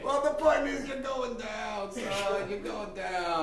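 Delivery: put-on brash swaggering lawyer with a big Mexican accent, shouting. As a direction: Socrates as a loud Mexican-accent braggart. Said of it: "I don't know why I'm Mexican".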